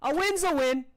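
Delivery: high, loud fighter's shrug; breezy Annabelle. Acted. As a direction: toss it off loudly, pitched well up. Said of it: "She goes".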